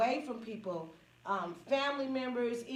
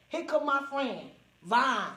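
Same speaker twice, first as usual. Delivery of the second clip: normal talking voice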